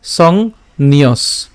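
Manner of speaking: "সংন্যস" very slowly, closing on the s; pronunciation demo. Shown as a demonstration. Teacher shows how "সংন্যস" is said, very slow. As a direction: stretch "সংন্যস" in two parts, stopping on s. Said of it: "উচ্চারণটা কেমন হবে".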